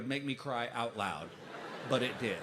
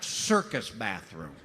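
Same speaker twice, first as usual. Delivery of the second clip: slow comic emphasis; 5 semitones higher, 4 dB louder, slower than usual. Real, not acted.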